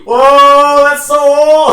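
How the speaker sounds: high, loud, sing-song whoa; hammed-up shock at something new; mock amazement. Mock amazement at Halo three.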